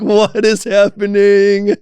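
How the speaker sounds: high, drawn-out acted cry of disbelief; overwhelmed bewilderment. Reliving being overtaken by God's energy, wildly bewildered. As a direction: stretch it out high, as if swept away. Acts out bewildered ecstasy, half laughing.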